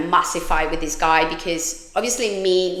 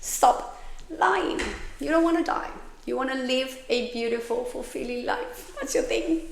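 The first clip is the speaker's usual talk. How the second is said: high, softer self-lecture; high but softer telling-off of herself; high soft scolding; 5 semitones higher, 7 dB quieter than usual. Retold or imitated. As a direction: talk herself down, high and quiet.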